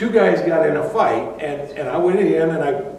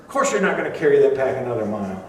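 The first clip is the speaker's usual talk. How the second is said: brusque dismissal; much faster than usual. Pretend. Soldier dismissing the offer.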